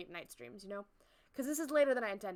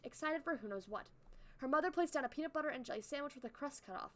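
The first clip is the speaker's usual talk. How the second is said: usual speaking voice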